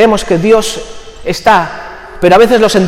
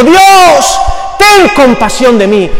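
desperate cry; 4 semitones higher, much slower than usual. Retold or imitated. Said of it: "un grito de desesperación diciendo".

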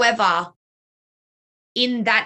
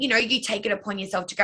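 normal talking voice

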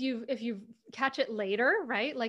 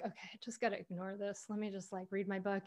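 low, hushed self-talk; quietly reenacted inner monologue; trying to ignore it; 3 semitones lower, 11 dB quieter, faster than usual. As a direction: talk yourself down under your breath. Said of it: "I was just trying to, like".

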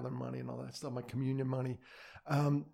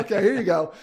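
mock God voice; 7 semitones higher, 12 dB louder than usual. God finally letting them in.

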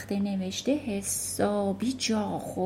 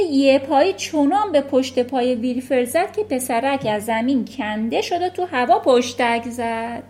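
ordinary delivery

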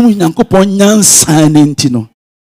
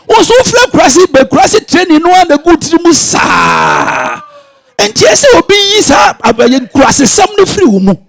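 fervent preaching; 6 semitones higher than usual. Real, not acted. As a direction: push it out with full fervour. Preacher rising to full force.

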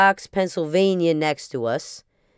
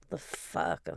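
muttered aside; 11 semitones lower, 12 dB quieter than usual. Retold or imitated. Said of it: "So I'm like".

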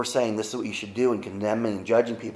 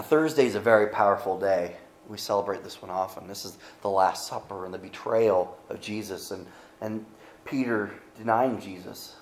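ordinary delivery

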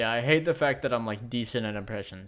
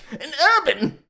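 Frieza voice; 13 semitones higher, 6 dB louder than usual. Acted. Mocking an impression.

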